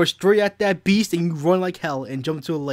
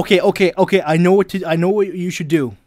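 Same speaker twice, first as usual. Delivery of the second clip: eager loud; 8 dB louder, faster than usual. Real, not acted. Eager to give advice.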